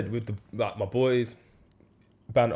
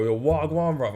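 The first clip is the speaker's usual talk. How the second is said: higher mock street greeting; mocking wannabe slang; 6 semitones higher than usual. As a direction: send up a try-hard faking London slang. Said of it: "if people come and go".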